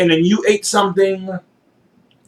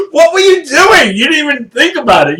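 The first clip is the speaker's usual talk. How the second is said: laughing; 7 semitones higher, 4 dB louder than usual. Real, not acted. Laughing at partner eating peanuts.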